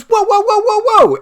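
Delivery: high, loud panicked string of whoas; alarmed. Acted. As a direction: shout to stop someone reaching for a weapon. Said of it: "and he goes".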